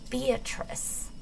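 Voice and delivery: soft, high, barely voiced; weak whisper. Paralysed girl weakly whispering to Tobias. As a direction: breathe the name faintly.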